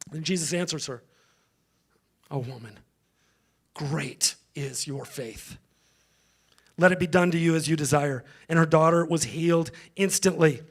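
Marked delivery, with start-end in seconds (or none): 2.3-8.2 tender reassurance. A narrator's reading. Jesus praising a woman's faith, tender and hushed. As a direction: soften right down and let it breathe.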